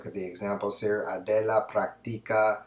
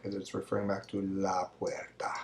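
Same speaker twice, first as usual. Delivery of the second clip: quiet aside; 8 dB quieter than usual. Real, not acted. Teacher explaining answer.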